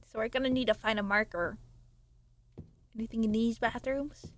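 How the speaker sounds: ordinary delivery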